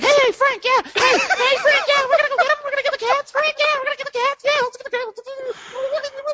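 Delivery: very high, excited yapping cartoon dog; yappy little dog. Little dog yapping at the big bulldog, very high and eager. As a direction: yap it out like the little sidekick dog. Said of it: "that little yappy dog".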